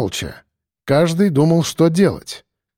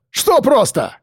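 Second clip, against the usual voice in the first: high, squealed retort; squealing; 9 semitones higher, slower than usual. A narrator's reading. Policeman snaps at Mordyukov's answer, squealing. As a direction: shriek it back, much higher. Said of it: "взвизгнул Чинчаков".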